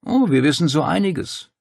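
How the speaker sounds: knowing, insinuating tone; meaningfully. A narrator's reading. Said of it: "sagte der Domitor vieldeutig".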